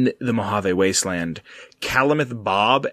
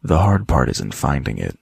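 deep villain voice; 11 semitones lower than usual. Retold or imitated. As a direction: drop the voice low and ominous. Quoting a game line, deep voice.

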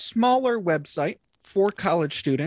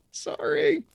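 squeaky sorry. Acted. Squeaky apology after the ad.

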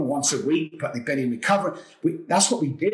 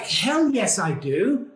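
eager yes; 7 semitones higher, much slower than usual. Retold or imitated. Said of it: "I'm like".

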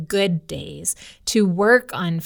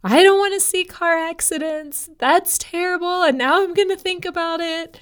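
put-on upset little boy; 10 semitones higher, 3 dB louder than usual. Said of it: "this is what had Daniel all worked up".

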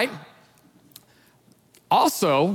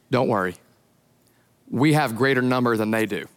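lower, unhurried reassurance; calm; 8 semitones lower than usual. Retold or imitated. Elisha calmly reassuring his servant, lower voice. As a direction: settle the panicked servant gently. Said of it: "he's so calm".

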